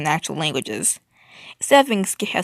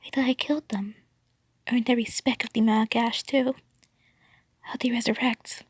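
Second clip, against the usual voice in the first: hushed, higher-pitched whisper in the ear; 5 semitones higher, 4 dB quieter than usual. A narrator's reading. Crying tenor whispering in a friend's ear, whispered. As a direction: whisper it close and secretively. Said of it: "whispered in my ear".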